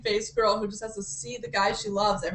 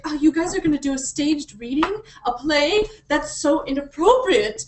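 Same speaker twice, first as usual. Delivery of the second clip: higher, louder scandalised tone; mock prudish; 4 semitones higher, 4 dB louder than usual. Mocking the prim heroine objecting to a play, prudish. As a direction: mimic an indignant prude, dragging out the disapproval. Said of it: "one of those hyper-religious".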